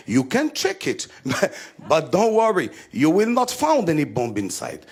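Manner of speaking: put-on lightly accented broken English; his English. Comedian reassures the airport guard, accented English. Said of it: "donc je lui ai dit".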